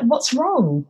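high, concerned question. Retold or imitated. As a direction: ask gently but with alarm. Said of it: "she was like".

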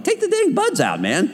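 high, exasperated mock plea. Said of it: "I'm like".

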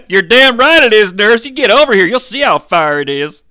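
loud, high-pitched outburst; heated rant. Acted. Dad to nurse about the burning bed, loud heated rant. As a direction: shout the nurse down, easing at the end.

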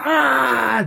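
high mock yelp of pain; hammed-up cry of pain; mock scream. Retelling Damon getting squeezed, high mock yelp.

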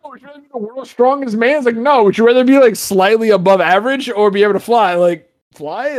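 high, sarcastic retelling of the question; mocking the question. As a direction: sneer at how silly the choice is. Mocking the would-you-rather question.

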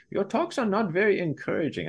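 a half-hearted mock German accent; quoting the German retreat participant in a botched German accent; failed German accent. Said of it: "I can't do a German accent".

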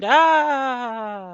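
high hammy scream of horror; mock scream. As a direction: shriek in fake terror.

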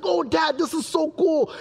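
high-pitched imitation of a thrilled son; happy little boy. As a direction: play a delighted kid showing Dad. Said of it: "he was so happy".

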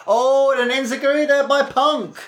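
high excited call of the kick. Real, not acted. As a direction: hype up the enziguri.

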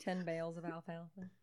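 put-on Florida country accent; lightly hammed-up Southern accent; mock rural accent. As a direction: flirt in a hokey country accent. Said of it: "He doesn't even have an accent".

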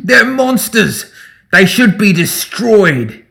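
furious high, loud accusation; enraged rabble-rouser nearly screaming; near-screaming anger. A narrator's reading. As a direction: shout it slowly, drawing out each outraged word. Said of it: "all but screamed in Reaver's face".

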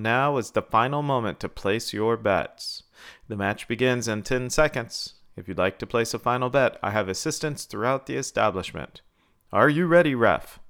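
usual speaking voice

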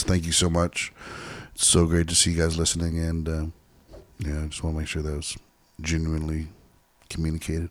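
soft, listless thanks; flat and unexcited. Real, not acted. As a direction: thank them with no energy. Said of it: "Don't sound so excited about it".

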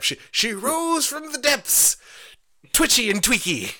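high, slow mock epic storyteller; stagey fake archaic declaiming. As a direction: declaim it like a hammy bard.

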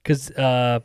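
ordinary delivery

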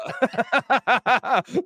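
loud, high burst of laughter at his own joke; big laugh. Real, not acted.

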